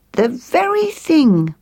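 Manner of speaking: high, slow, delighted chirp; a little bird finding just what it wanted; sparrow character. Acted. Sparrow watching twigs twined round, high and approving.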